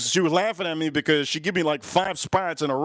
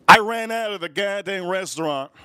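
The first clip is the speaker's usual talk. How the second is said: normal talking voice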